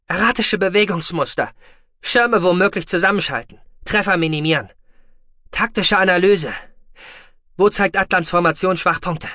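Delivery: high, loud crisp commands; commanding orders. Acted. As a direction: snap out orders to the fleet. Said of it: "befahl sie".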